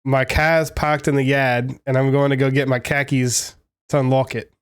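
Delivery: amateur Boston accent with "car keys" as khakis. Shown as a demonstration. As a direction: do your best Boston accent. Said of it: "I'm gonna try my best like, Boston accent".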